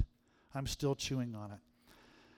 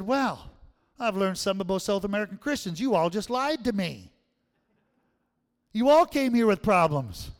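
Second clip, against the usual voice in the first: higher and louder, building to a near shout; preaching at the crowd; 7 semitones higher, 10 dB louder than usual. Retold or imitated. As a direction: call the whole hall out, rising in force. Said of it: "I said".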